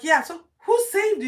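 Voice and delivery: excited shouting crowd. Retold or imitated. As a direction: yell it across the road in amazement. Crowd asks eagerly.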